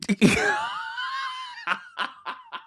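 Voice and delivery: long high-pitched cackling laugh; real laughter. Real, not acted. Reader cracking up at a comment from Ace, real high laughter.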